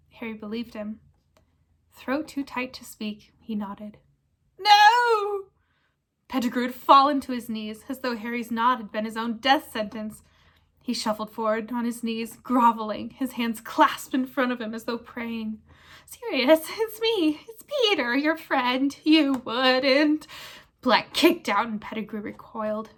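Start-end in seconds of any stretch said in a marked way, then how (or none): 4.6-5.5 high, loud cry of panic; terrified shout. Acted. Pettigrew cries out in terror. 16.0-20.3 high, loud, pleading squeak; whining plea. Acted. Pettigrew pleads with Sirius.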